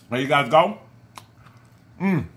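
usual speaking voice

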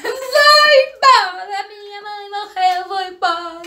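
high, loud, slow sobbing wail; crying wail. Retold or imitated. Daughter re-enacting her childhood panic, sobbing and wailing. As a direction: wail it out like a scared child whose mother died. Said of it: "a menina num griteiro".

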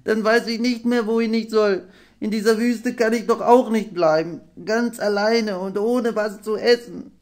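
high, stammering small-dragon voice; squeaky young dragon, faltering; stammering little half-dragon. A narrator's reading. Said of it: "stammelte er".

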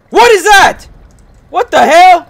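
very high, loud startled scream; high, loud squeal of fright; freaked-out shriek. Real, not acted. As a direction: shriek it at the jump scare. Jump scare in horror game.

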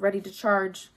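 plain reading voice

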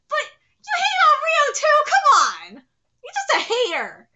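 high, loud, playful scolding; mock-outraged protest. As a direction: scold loudly in fan indignation. Mock quarrel defending favourites.

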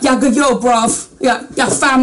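mock London slang accent; London street accent. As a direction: do a badly faked young London accent. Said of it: "I guess the accent's now more like".

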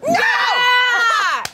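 high playful shouting. Real, not acted. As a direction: yell back at them. Teasing shouting match.

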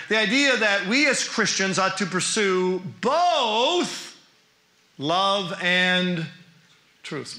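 BOTH drawn out slowly, then a pause; stress on BOTH. Shown as a demonstration. Teacher testing listeners on the emphasized word, stress on "both". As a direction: lean long and hard on the word "both". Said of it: "I emphasized the word both".

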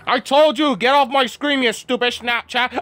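high-pitched ranting at the phone; angry outburst. Real, not acted. Player telling Snapchat off, angry outburst. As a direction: yell it in exasperation, pitch way up.

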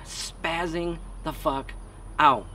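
ordinary talk, nothing marked

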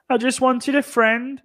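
high, slow mock pleading; Jake Hadley. Fighter caught in a sting, high panicked excuse. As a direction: plead innocence in a small high voice. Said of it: "Impression of Jake Hadley being stung by".

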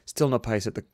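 plain reading voice

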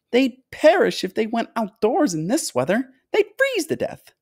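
high, piping tour guide; fussy high-pitched host, stretching key words; excitable fluty factory owner. A narrator's reading. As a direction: usher them along in a high, fluty voice. Story figure: fluty factory owner guiding.

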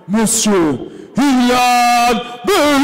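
long stretched-out name call; boxing ring announcer. Acted. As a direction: drag out every syllable for the crowd. Announcer calls fighter's name.

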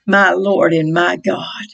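usual speaking voice

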